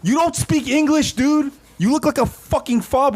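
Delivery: a jeering put-on voice; mock taunt. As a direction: taunt him in a high sneering tone. Mocking the tough-guy casting.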